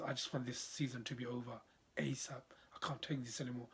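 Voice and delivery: hushed, defeated whisper; despairing whisper. Real, not acted. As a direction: whisper it like you've given up. Fan despairing over the season.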